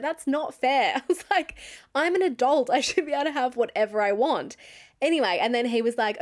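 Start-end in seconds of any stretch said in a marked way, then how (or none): 0.0-4.6 high-pitched mock outrage; indignant protest. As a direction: protest like someone denied a treat, pitched up. Retold protest against boyfriend's rule.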